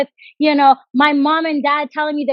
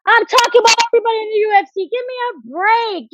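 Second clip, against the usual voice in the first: exasperated; 8 semitones higher than usual. Real, not acted. Defending fighter, exasperated.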